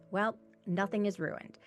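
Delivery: ordinary talk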